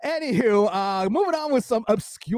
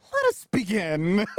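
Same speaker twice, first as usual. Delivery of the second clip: mock dramatic opener; 2 semitones higher than usual. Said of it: "I like how you start".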